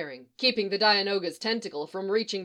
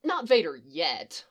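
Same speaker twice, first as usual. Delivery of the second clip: plain reading voice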